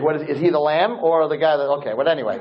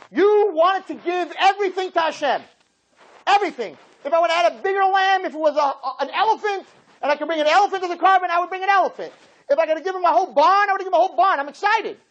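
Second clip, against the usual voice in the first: excited preaching. Acted. Reenacts eager man bringing offering.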